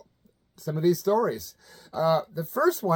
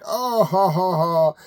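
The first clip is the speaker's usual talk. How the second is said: dismissive fake laugh; 5 dB louder, slower than usual. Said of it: "she tried to brush it off like".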